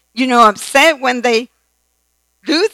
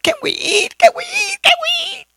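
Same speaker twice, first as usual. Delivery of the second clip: much higher, whining plea building to a cry; whiny begging child. Pretend. Hungry children begging during a long grace, high whiny plea. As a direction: whine like a starving kid.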